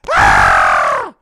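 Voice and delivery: loud shrieking scream; terrified scream. Pretend. Father seeing his family turn into gargoyles, screaming. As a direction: scream in full panic. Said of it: "I'd be there going".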